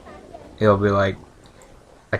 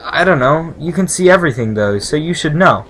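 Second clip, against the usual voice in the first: put-on higher-pitched evasive girl; nervous servile girl; 5 semitones higher, 7 dB louder than usual. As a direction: answer as a worried young servant. Voices nervous girl answering the Shaper.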